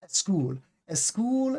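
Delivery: put-on "school" as eh-school, Spanish learner accent; Spanish-speaker accent. Teacher demonstrating the number one mistake, Spanish accent. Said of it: "number one Spanish-speaking English mistake".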